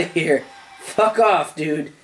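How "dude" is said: ordinary talk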